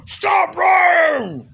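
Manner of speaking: high, loud yell; shouted accusation. Retold or imitated. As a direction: yell it like the whole crowd. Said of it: "She said".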